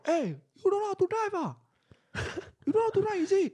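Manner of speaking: high yelling road-rager; shrill angry driver shouting from his car; mock shout. Driver shouting at the bikers, high mock yell. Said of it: "he shout".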